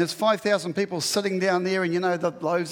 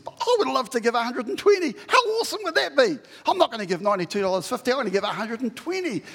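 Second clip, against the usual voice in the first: higher, bubbling with eagerness; delighted giver; 5 semitones higher, faster than usual. Acted. Preacher voicing a joyful giver, eager and delighted. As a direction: gush about giving more.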